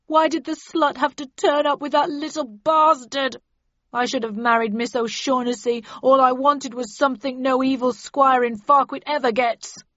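loud, high, acted lament; tearful, self-pitying outburst; sobbing. A narrator's reading. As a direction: wail out your grievance. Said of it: "he sobbed".

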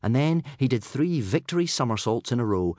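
ordinary delivery, nothing marked

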